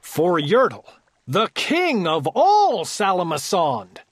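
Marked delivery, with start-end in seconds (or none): none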